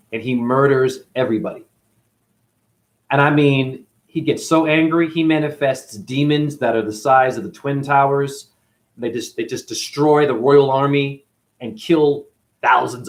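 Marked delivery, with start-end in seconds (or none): none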